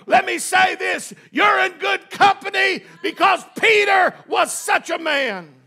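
high, shouted exhortation, stretched phrases; full-force preaching. Real, not acted. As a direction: drive it home to the congregation.

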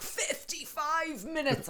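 high, slow shocked cry. Retold or imitated. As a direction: squeal it out in disbelief. Shocked at traffic app time.

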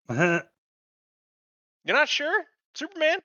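slow, high incredulous questioning; mock disbelief. Fan challenging Superman for being unsure, drawn-out mock disbelief. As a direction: draw it out high, doubting every word.

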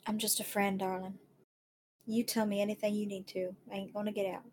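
calm her down gently; soft reassurance. Acted. Friend reassuring the sobbing woman, soft and tender. Player comforting softly.

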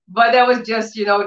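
normal talking voice

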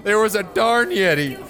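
suara tinggi kurcaci polos yang menangis; dwarf naif yang tersedu, nada tinggi; Sandwich Ralph, tearful. Acted. GM voices Sandwich Ralph's tale.

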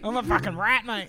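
mock bogan. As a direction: ham up a proud rat. Mocking self-deprecating drunk.

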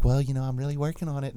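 put-on soft, meek young player; soft little kid. Host mimicking the demure player, soft like a little kid. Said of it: "soft kinda, almost like a little kid".

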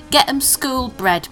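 usual speaking voice

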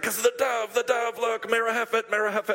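pointing out a dove, thrilled; excited pointing. Retold or imitated. Traveler telling the tour group about the dove, excited. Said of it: "started freaking out at the group".

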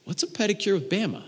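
high, small voice imitating a child; puzzled little kid. Son asks dad about his prize, puzzled. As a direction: ask it like a baffled four-year-old.

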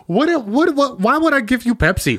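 high, incredulous what what what; exasperated disbelief. Retold or imitated. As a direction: throw up your hands in disbelief. Baffled by a Pepsi accusation.

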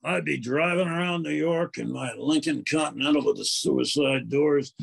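far Rolf the dog voice. Acted. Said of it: "is Rolf".

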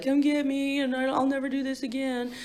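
high, soft begging put on; calling her parents from jail, mock whine; mock whiny pleading.

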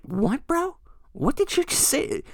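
high, squeaky disbelief; incredulous. Retold or imitated. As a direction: act stunned he even asked. Retold incredulous reply to stranger.

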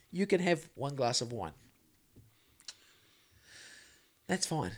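soft, low, gently voiced spiritual teacher; Eckhart Tolle. Retelling the teacher's take on alcohol, softly and low. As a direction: lightly imitate a hushed, permissive guru without the accent. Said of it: "but he talks about how".

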